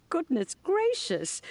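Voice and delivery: high, slow, amused gasp of surprise; mock surprise. Old lady teasing flattering youngsters, amused mock surprise. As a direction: feign being startled by flattery.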